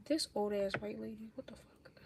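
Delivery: a hushed unvoiced whisper under her breath; whispered aside. Real, not acted. Whispering about the neighbor lady letting her dog on the lawn. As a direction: whisper it so the neighbor can't hear.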